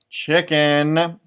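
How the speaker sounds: usual speaking voice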